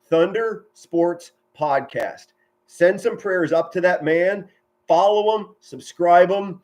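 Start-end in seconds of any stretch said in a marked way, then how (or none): 0.1-2.3 slow, emphatic "Thunder Sports Podcast"; "Thunder Sports Podcast" stretched, each word separate; deliberate repeated plug. Real, not acted. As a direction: spell out "Thunder Sports Podcast" slowly so it sticks. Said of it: "I'll say that again".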